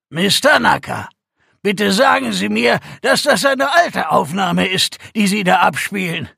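a shaken commander demanding answers; alarmed commander. Acted. Commander reacts to hostile broadcast.

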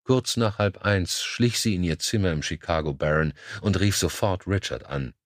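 usual speaking voice

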